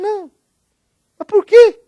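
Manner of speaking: high, loud, near-tears plea; a child's tearful high cry; crying why. Retold or imitated. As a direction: beg to know why, voice breaking. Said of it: "o terceiro porquê já tava, né, chorando".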